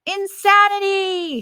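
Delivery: a drilled class answer, shouted together; mock chorus shout of the whole class; class shouting in unison. A narrator's reading. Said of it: "shouts everyone in my class".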